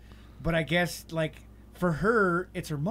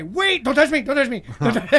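higher, louder repeated don't touch me; panicked plea; 7 semitones higher, 8 dB louder than usual. Retold or imitated. Tripping friend backing off from the others, loud and panicky. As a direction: back away pleading. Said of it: "I'm gonna go back and say".

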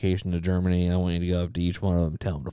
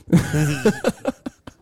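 high burst of laughter; 7 semitones higher than usual. Real, not acted. Laughs at own joke.

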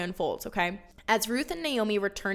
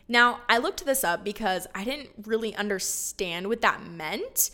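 ordinary delivery

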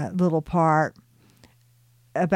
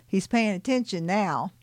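usual speaking voice

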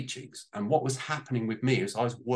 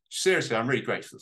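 ordinary talk, nothing marked

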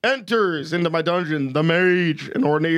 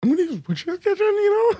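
mocking imitation; 13 semitones higher than usual. Mimicking friend's announcement.

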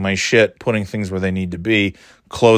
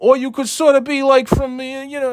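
high, put-on Boston-New York accent. Shown as a demonstration. Comedian demoing a fake East Coast accent for casting. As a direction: slide into a jokey regional accent. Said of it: "or you could be from New England and do that".

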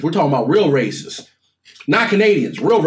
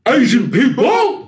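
laughing; 9 semitones higher, 4 dB louder, slower than usual. Real, not acted. Laughing while naming the race.

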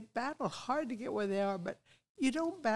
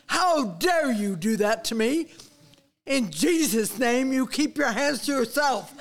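outraged rebuke; 8 dB louder than usual. Retold or imitated. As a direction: tell him off loudly, every word weighed. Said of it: "Don't ever speak like that".

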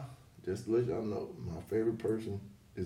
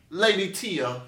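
slow, announced British title, pitched up; mock British accent; 8 semitones higher, 7 dB louder, much slower than usual. Host introducing guest by a grand title, mock British accent. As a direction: put on a drawn-out British accent. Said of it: "how you say it in British".

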